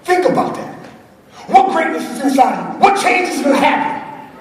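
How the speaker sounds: rally the room like a revival preacher; preaching to the crowd. Acted. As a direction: speaker firing up the audience, in full preaching voice. Motivational preaching.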